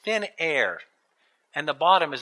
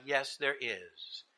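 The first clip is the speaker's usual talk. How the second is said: low, slow, soft voice from heaven; calm, powerful voice; 6 semitones lower, 12 dB quieter, slower than usual. A narrator's reading. Voice from the sky answers the tourist, calm and powerful. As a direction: answer with unhurried authority. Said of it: "A calm, powerful voice came out of the sky".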